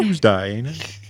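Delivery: hammed-up British slipping Australian; mock British. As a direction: put on a playful English accent. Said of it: "It's British Tuesday".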